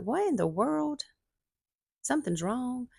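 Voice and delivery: alarmed disbelief. Retold or imitated. To herself, sensing her husband is hiding something, alarmed disbelief. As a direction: catch the first hint that something is off. Wife suspecting husband's absence.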